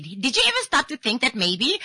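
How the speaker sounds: high, loud mimicry of the caller; mock the caller's perky defence up high; high-pitched imitation. Host mimicking caller defending how they sound, high-pitched. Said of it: "high pitch and everything".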